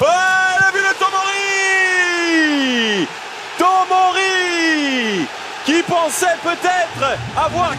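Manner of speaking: soar up and stretch out the goal; excited sports commentary. Real, not acted. Goal call for Tomori. (annonce de but exaltée)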